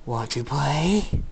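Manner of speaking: slow, drawn-out crazed taunt; insane villain. A narrator's reading. Insane attacker taunting his victims, crazed drawl. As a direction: stretch it out like a madman toying with them. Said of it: "go into an insane look".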